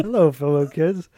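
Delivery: lightly voiced awkward grown-up; out-of-touch adult. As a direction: greet them like a fake teen. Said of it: "But I said that".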